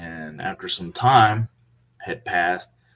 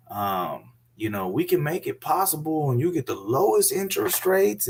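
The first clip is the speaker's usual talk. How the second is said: higher, sugary sales pitch that slows at the greeting; butter him up like an eager banker; sweet-talking bank staff; 7 semitones higher than usual. Retold or imitated. Bank staff courting Mister Kyle, high fawning sales voice.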